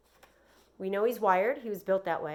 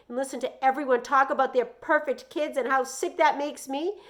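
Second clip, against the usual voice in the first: higher, louder indignant mother; angry mom; 6 semitones higher, 8 dB louder than usual. A narrator's reading. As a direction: vent the hurt at the boy. Mom venting angrily.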